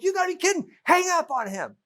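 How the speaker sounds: mock the husband telling her to hang up; John voiced. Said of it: "John's like".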